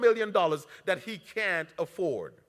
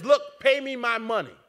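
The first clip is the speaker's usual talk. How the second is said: demanding servant; 5 semitones higher, 6 dB louder than usual. Acted. Servant choking debtor, demanding.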